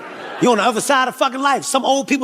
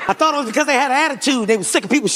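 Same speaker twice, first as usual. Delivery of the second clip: usual speaking voice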